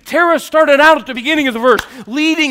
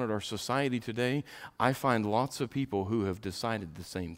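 hushed preaching; 12 semitones lower, 15 dB quieter than usual. Acted. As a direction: lean in and drop it low. Preacher drops to hushed intensity.

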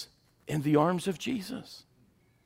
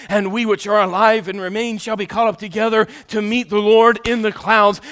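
ordinary delivery

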